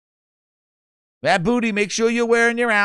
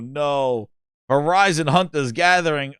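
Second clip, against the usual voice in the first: ordinary talk, nothing marked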